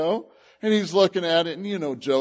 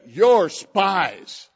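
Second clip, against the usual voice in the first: accusing ruler; 3 semitones higher, 6 dB louder than usual. Retold or imitated. Joseph accusing the visiting brothers, a stern ruler's charge. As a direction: voice of a suspicious Egyptian ruler, pressing hard.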